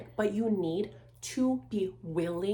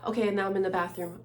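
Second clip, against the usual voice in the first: normal talking voice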